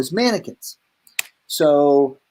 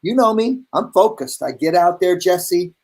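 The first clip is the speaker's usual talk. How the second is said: usual speaking voice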